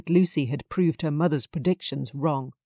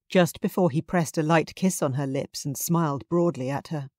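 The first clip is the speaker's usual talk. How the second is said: ordinary delivery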